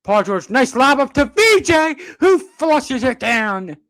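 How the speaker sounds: loud, high shout, stretching out the finish; real play-by-play excitement at full volume; thrilled dunk call. Real, not acted. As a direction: erupt as the lob becomes a slam. Calling an alley-oop dunk.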